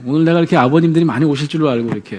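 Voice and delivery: normal talking voice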